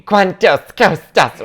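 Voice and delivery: slow, loud, high-pitched mock yell of a clueless tourist; mocking screaming tourist. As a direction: drag out each word like a loud lost tourist.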